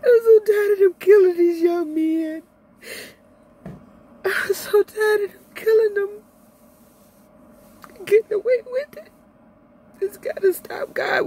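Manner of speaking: real tears while speaking; crying out in grief. Real, not acted. Crying over police killings.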